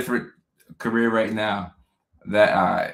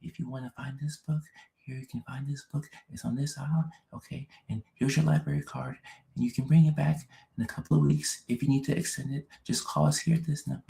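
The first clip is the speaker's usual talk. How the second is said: hushed, higher, softly spoken helper; soft, raised-pitch librarian helping a visitor; quiet librarian voice; 5 semitones higher, 5 dB quieter than usual. Acted. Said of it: "They talk like this".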